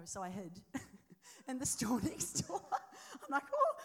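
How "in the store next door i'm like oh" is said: high, loud laughter through the words; laughing. Real, not acted. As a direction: laugh at yourself while telling it. Laughing at own hiding.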